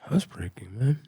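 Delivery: hushed, breathy confession; whispered answer. Real, not acted. Narrator admitting to interviewer where guns came from, whispered. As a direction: let it slip under the breath.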